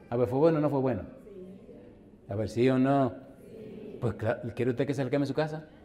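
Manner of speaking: ordinary talk, nothing marked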